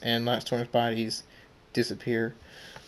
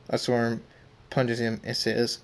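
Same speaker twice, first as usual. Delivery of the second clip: ordinary delivery, nothing marked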